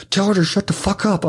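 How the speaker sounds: high, exasperated retold order; exasperated plea to hush someone; urgent order. Retold panicked order over her screaming.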